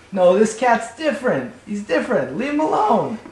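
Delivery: high-pitched pleading warning; urgent convincing. Acted. Neighborhood cats trying to convince the new cat, high urgent plea. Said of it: "they'd try to convince him".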